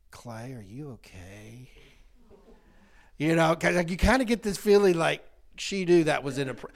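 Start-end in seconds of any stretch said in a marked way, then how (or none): 0.1-1.7 soft, slow, much quieter worried question; gentle concern. Retold or imitated. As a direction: ask like a kind aide checking on a hurt kid. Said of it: "That teacher's aide was like".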